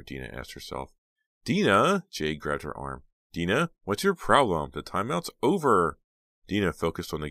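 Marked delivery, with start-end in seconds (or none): 1.4-2.0 loud call of her name. Acted. Friend grabbing her attention. 3.3-6.0 impatient. Acted. Friend urging impatiently.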